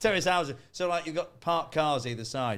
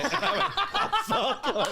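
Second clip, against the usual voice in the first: laughing; 3 dB louder than usual. Retold or imitated. Caught by the neighbour, laughing.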